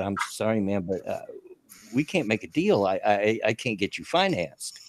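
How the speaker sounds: quiet, regretful salesman; soft apology. Retold or imitated. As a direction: gently break the bad news. Salesman apologizes softly to customer.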